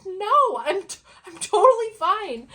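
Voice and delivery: high, loud insistence she's fine; loud laughing protest. Retold or imitated. Laughing protest to hallway colleague.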